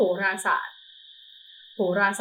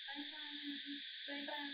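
quiet bye to the dog; 4 semitones higher, 26 dB quieter than usual. Real, not acted. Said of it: "คุณแซนติก บ๊ายบายก่อน".

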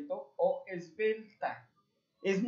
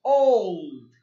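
emphatic; 10 dB louder than usual. Shown as a demonstration. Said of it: "para escribir".

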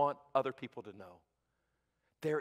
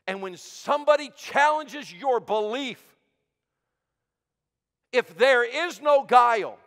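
high, forceful preacher delivery with weighty pauses; emphatic preaching; 9 semitones higher, 11 dB louder than usual. Real, not acted. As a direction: drive each phrase home to the congregation.

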